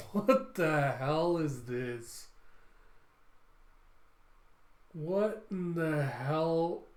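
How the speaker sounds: very slow, drawn-out disbelief; baffled astonishment. Real, not acted. Reader reacting to a bizarre transformation, slow stunned disbelief. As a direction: stretch each word out, stunned.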